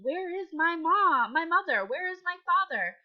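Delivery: a little bear pleading for its parents; anxious asking. Acted. Teacher voicing the bear's questions, mildly anxious. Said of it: "he's asking".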